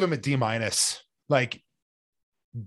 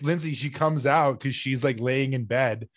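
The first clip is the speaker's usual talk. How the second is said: ordinary talk, nothing marked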